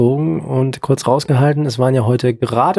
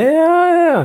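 high squeaky voice. Acted. Playful high reply.

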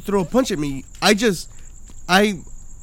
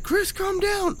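very high mock plea; high calming call, not shouted; alarmed friends; 13 semitones higher, 4 dB quieter, slower than usual. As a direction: imitate friends pleading in a squeaky-high pitch. Everyone telling him to calm down.

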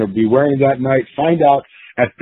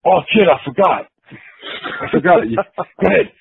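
Dory imitated; 6 semitones higher than usual. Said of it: "And he goes".

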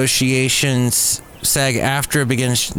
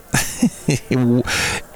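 short laugh. Real, not acted. Laughing at own yawn bit.

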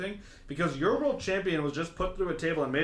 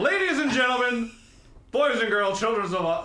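booming ring announcer intro, louder and higher; grand entrance announcement; 8 semitones higher, 6 dB louder than usual. Pretend. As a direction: call it out like a wrestling announcer.

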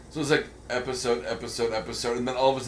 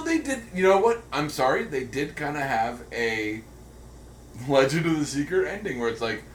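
usual speaking voice

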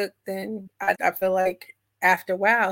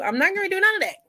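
emphatic, pitched-up no-way; firm refusal; 11 semitones higher, much faster than usual. Real, not acted. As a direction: shut it down firmly. Refusing to be controlled.

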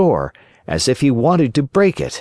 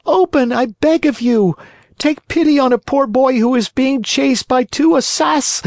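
high, imploring puppet voice; pleading cry. A narrator's reading. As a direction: call up to the window. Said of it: "cried Pinocchio".

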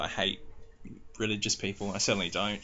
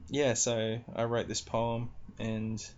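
usual speaking voice

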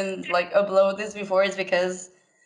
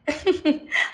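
short high giggle; high laugh; 8 semitones higher than usual. Real, not acted. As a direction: burst out giggling. Laughs at sexy voice joke.

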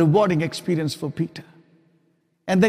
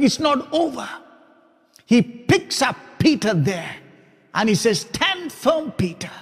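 preaching Jesus' words; 5 semitones higher than usual. Acted. Preacher voicing Jesus to Peter, building to a fervent cry. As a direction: proclaim it, rising to full force.

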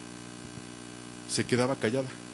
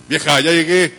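shouted into the house, much louder; rough husband arriving; 10 dB louder than usual. Acted. Careless husband announcing himself to his wife, loud call. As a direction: bellow it from the doorway.

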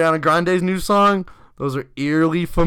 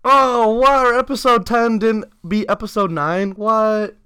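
surprised; 8 semitones higher, 5 dB louder than usual. Real, not acted. Shocked at download numbers.

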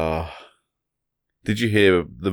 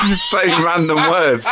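laughing; 8 semitones higher, 6 dB louder, slower than usual. Real, not acted. Laughing comment.

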